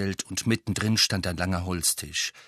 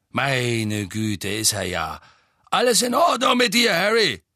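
put-on rough old rogue voice; 3 semitones higher, 5 dB louder than usual. Mundungus greets Harry.

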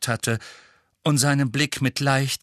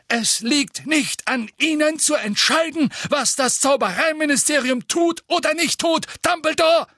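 higher, louder angry hiss; hissing angrily; 4 dB louder than usual. A narrator's reading. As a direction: spit it out in anger. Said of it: "fauchte Fudge".

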